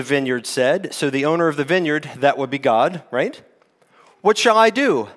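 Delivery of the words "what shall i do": owner's worried question. Acted. Owner of vineyard wondering aloud.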